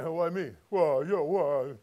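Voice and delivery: a lightly voiced whiny gripe; mock whining. Preacher mocking a griping cross-bearer, light whine. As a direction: poke fun at the complainer with a whine. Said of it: "You see him saying".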